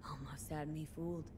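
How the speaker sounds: breathy hushed aside to himself; whispered aside. Real, not acted. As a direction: say it under your breath, half impressed. Quiet aside to the machine.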